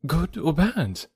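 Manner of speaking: quick, eager question. A narrator's reading. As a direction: ask with lifted voice. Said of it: "quickly, looking up in his face".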